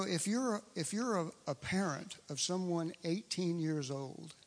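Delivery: ordinary talk, nothing marked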